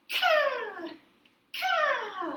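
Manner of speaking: high cawing bird. Shown as a demonstration. Storyteller voicing the bird's call for children. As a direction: caw like a big crow. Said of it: "Try it with me".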